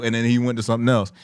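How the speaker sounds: ordinary delivery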